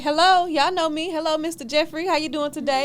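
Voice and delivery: high, loud friendly hello; cheery greeting. Acted. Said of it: "playful courtroom greeting".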